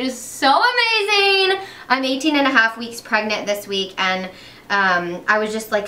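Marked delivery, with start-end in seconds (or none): 0.0-1.6 much higher, slow swooning delight; gushing. Real, not acted. Telling viewers about the baby's first kicks, high and gushing. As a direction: melt over the word amazing.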